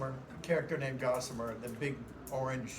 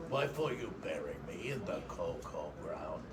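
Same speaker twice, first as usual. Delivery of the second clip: Taz; 10 semitones lower, 7 dB quieter than usual. Acted. Voice actor showing the few things Taz did say, low Taz voice. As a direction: growl it out as Taz, deep and quiet. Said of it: "I can't say that as Taz".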